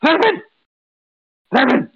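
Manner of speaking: calling out in a strained whisper-shout; whisper yelling. Acted. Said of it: "I'm, like, whisper shouting".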